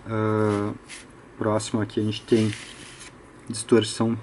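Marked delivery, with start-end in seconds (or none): none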